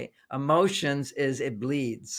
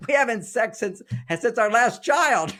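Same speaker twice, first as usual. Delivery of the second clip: higher, louder, laughing through the words; laughing confession; 7 semitones higher, 5 dB louder than usual. Retold or imitated. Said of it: "she starts laughing".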